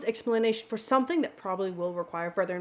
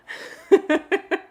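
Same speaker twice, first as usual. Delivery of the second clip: laugh; 7 semitones higher, 4 dB louder than usual. Real, not acted. Laughing at own bad accent.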